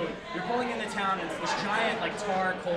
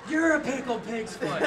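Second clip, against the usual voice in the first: slow, higher mock drunk yell; 6 semitones higher, 4 dB louder than usual. Teller imitating his drunk self, slow and higher. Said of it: "I'm like".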